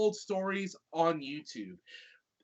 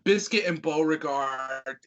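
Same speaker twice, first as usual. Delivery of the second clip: normal talking voice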